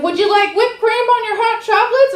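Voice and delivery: high, sing-song barista offer; lightly mocking the fancy apron fellow. Comic imitating a fancy barista offering whipped cream. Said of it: "He was like".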